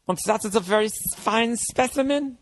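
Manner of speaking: hammed-up doctor savouring FINE SPECIMEN, pitched high; Nazi doctor. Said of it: "one of those Nazi fucking doctors".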